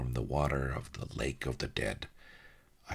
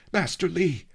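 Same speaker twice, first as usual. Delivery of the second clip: much higher, louder urgent shout; gasped cry; 8 dB louder than usual. A narrator's reading. Diver calls again to the raft, high gasped shout. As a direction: call out urgently for your master. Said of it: "I gasped".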